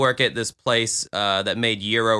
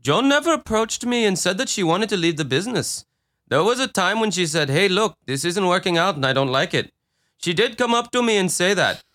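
higher, fast Indian accent impression of Patel; mock Indian accent; 7 semitones higher, faster than usual. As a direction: read Patel's quote in a put-on Indian accent. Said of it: "He just wants to do the accent".

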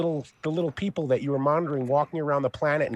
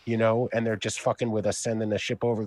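normal talking voice

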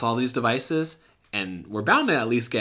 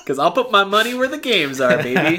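laughing brag; 10 semitones higher, 5 dB louder than usual. Real, not acted.

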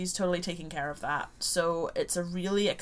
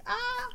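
high delighted squeal; a sudden thrilled shriek; excited squeal. Retold or imitated. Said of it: "and I was like".

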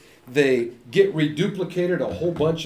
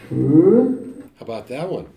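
surprise sound; 6 dB louder, much slower than usual. Shown as a demonstration. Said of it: "When you're surprised, what do you say?".

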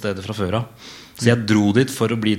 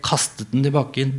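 ordinary delivery, nothing marked

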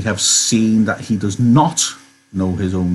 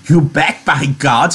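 higher, delighted exclamation; happy cry; 5 semitones higher than usual. Acted. Lenny agreeing about velvet, crying out happily. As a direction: blurt it out in simple joy. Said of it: "he cried happily".

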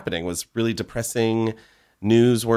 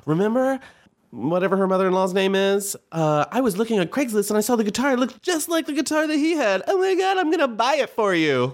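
much higher, hammed-up astonishment; fake surprise; 11 semitones higher than usual. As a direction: gush like you just stumbled on it. Acting fake discovery to mother-in-law.

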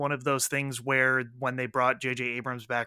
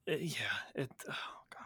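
whispered groan; 3 semitones lower, 17 dB quieter, much faster than usual. Real, not acted. Despairing aside at Star Wars.

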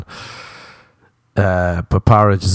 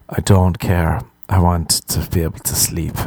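ordinary delivery

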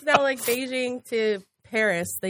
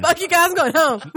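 mock angry; 7 semitones higher, 7 dB louder than usual. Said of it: "She's like".

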